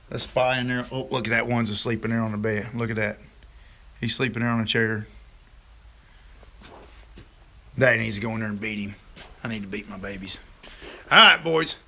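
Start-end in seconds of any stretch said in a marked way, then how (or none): 0.1-8.9 hushed peeking. Real, not acted. Peeking at sleeping kids. 9.4-10.4 whispered joke. Real, not acted. Joking beside sleeping kids.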